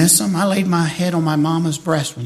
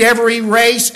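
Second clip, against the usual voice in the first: ordinary talk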